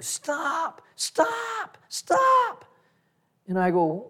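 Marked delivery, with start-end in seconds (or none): 0.0-2.6 very high-pitched mock scream; retold screaming. Pastor's wife yelling at the basketball players, imitated screaming. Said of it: "and she's screaming".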